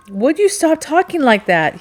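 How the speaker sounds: loud, high-pitched outburst; angry demand. A narrator's reading. Captain rounds on Rachel for how she talks, angry demand. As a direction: demand it in sudden exasperation. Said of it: "he demanded".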